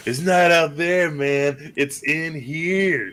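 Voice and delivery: high, slow dreamy stoner. Retold or imitated. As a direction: drag it out like a spaced-out hippie. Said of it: "what he said".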